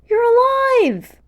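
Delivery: high, loud and slow with joy; drawn-out happy disbelief; overjoyed. Acted.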